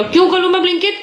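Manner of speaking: high, rising mock refusal; teasing protest. Real, not acted. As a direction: refuse him playfully. Repeating the protest to son.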